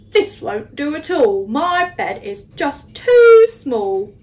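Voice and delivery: slowing, rising dismay; worried Percy. Acted. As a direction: fret about the cramped bed. Percy worrying about small bed.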